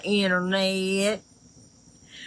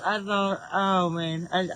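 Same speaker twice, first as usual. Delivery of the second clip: ordinary delivery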